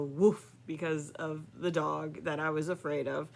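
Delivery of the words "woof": mock woof. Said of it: "They'll just look at me and go".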